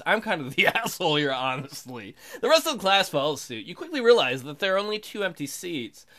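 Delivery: ordinary talk, nothing marked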